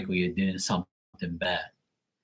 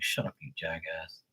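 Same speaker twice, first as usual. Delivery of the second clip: low, much softer under-the-breath retort; muttered put-down; 5 semitones lower, 13 dB quieter than usual. Retold or imitated. Streamer replies to the dumb guy, low muttered put-down. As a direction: mutter the insult to yourself.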